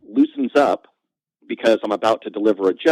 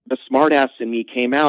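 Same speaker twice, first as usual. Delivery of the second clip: ordinary talk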